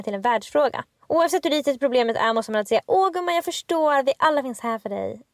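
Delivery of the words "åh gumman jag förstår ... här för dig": put-on slow, gushing Stockholm accent; a lightly done Stockholm accent. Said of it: "Gillar du min Stockholmsdialekt?".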